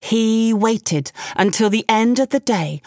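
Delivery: usual speaking voice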